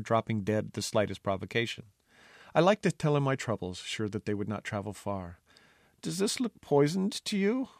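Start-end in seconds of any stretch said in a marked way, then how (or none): 6.0-7.7 put-on a thin, higher worried boy; a timid young taster, lightly voiced; sickly lad's voice. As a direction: voice the frail food taster fretting. Sickly Taster asks the jester.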